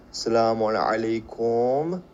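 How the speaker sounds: very slow, drawn-out سلام علیکم; slow, careful Arabic greeting. Shown as a demonstration. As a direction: model سلام علیکم slowly for learners.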